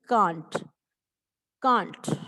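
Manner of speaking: "can't" as one falling syllable; pronunciation model. Shown as a demonstration. Teacher showing the joined word, "can't" said as kaant. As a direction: say CAN'T as kaant, then repeat it. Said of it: "और इसको बोलते".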